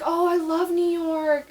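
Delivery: high, drawn-out fake enthusiasm; mock gushing. Imitating people who hear New York, high mock gushing.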